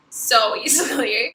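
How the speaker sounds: usual speaking voice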